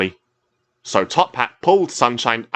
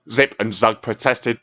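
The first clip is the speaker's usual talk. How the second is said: plain reading voice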